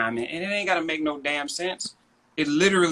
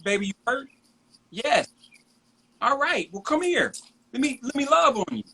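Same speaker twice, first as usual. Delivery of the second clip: tender comfort; 11 semitones higher than usual. Retold or imitated. Father comforting hurt son.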